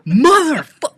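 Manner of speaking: high, loud mock outburst; imitated cussing out. Lady going off on cart vigilante, mock furious cussing. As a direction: start to curse him out, then cut off. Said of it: "this lady was cussing him out".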